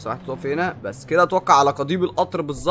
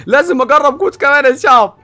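eager; 9 semitones higher, 8 dB louder than usual. Real, not acted. Eager to try code.

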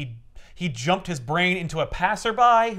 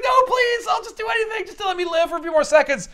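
much higher, louder desperate begging; shrieking plea; 4 dB louder than usual. Retold or imitated. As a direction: beg for a few more seconds. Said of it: "And she's like".

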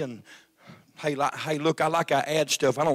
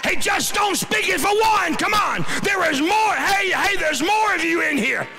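full-force preaching; 13 semitones higher than usual. Real, not acted. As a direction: preach it hard, building the fire.

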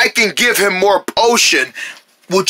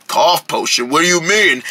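ordinary delivery

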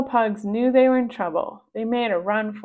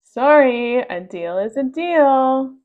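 louder, slow, firm pug; loud refusal; 3 semitones higher, 7 dB louder, slower than usual. Acted. The pug refuses the girls' refund, loud and firm. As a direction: shut them down flatly and loud.

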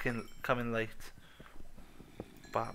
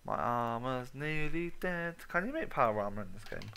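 ordinary talk, nothing marked

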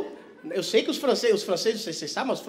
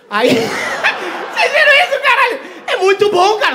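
high, loud laughing shout; 11 semitones higher, 9 dB louder, slower than usual. Real, not acted. Comic laughing at the debate brawl, loud and high. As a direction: burst out laughing as you yell it.

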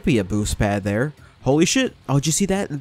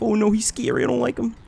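mock scared; 8 semitones higher than usual. Player reacting to scary villain, high mock fright. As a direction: squeak it out in pretend fear.

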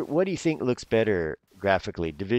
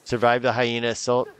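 ordinary talk

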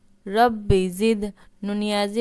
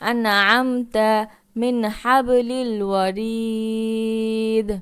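slow chanted tilawat; slow recitation; much slower than usual. Shown as a demonstration. Teacher reciting the verse slowly for learners. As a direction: draw out the words like Quran recitation.

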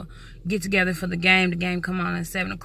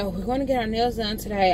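ordinary talk

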